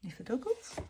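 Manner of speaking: "Nifferdoggles" asked with a rising tune; puzzled question. Real, not acted. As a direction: wonder aloud what "Nifferdoggles" means.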